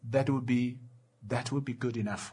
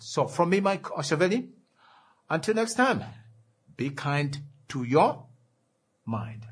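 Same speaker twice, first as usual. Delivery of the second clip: upbeat radio sign-off; 6 semitones higher, 5 dB louder than usual. Real, not acted. Radio host signing off.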